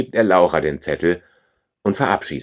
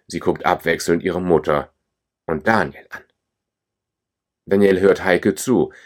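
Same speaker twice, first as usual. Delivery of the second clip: plain reading voice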